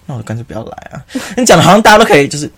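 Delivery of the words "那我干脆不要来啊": much softer muttered complaint; under-breath grumble. Retold or imitated. Imitating a classmate grumbling under breath. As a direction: mutter it so only your neighbour hears. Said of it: "他就是那种小小声说".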